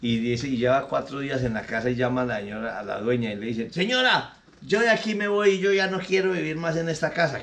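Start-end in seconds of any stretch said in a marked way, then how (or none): 3.7-7.4 higher, fed-up maid quitting; indignant maid. Acted. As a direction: announce you're leaving in a high huff. Maid tells employer she quits.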